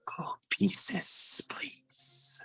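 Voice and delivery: slow eerie whisper; creepy voice. Acted. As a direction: drag the price out creepily. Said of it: "in their creepy voice".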